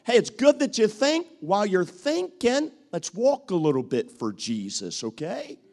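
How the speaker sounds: rally a hesitant person to come along; excited coaxing call. Retold or imitated. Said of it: "We'll take somebody like this and say".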